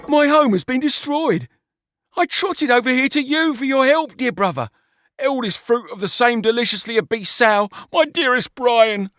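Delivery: high, hammed-up weeping plea; melodramatic weeping. A narrator's reading. George begs his brother Brian, hammed-up weeping. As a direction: overplay the sobbing plea. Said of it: "George wept".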